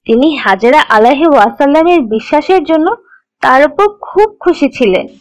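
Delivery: usual speaking voice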